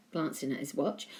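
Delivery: plain reading voice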